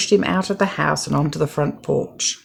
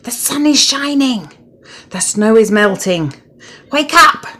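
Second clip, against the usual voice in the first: high, eager voice of a cheerful frog; excited urging; 6 semitones higher, 4 dB louder than usual. A narrator's reading. Frog eagerly telling Toad it's spring. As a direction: coax him out, bubbling over.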